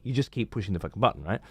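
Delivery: ordinary delivery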